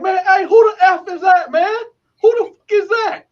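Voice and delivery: imitated high, loud calling out; retold irate yell. Inmates on the tier demanding who is making noise, retold yelling.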